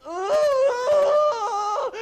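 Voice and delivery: high, loud, crying shriek as the train drops; retold scream. Child on a roller coaster dropping, crying out in terror. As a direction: let out a real yelp of terror. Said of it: "And I was crying".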